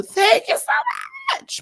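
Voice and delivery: sudden high, loud squeal of thanks; shouted thanks. Acted. Host thanking tippers on the stream, over-the-top shout.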